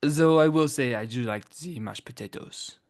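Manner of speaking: exaggerated French accent; mock French accent. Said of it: "we doing French accents".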